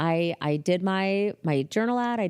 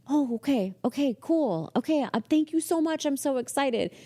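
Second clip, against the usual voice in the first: high, eager, grateful reply; flustered thanks; 8 semitones higher than usual. Retold or imitated. Receptionist thanking the boss, flustered and excited. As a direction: gush thanks with the voice climbing.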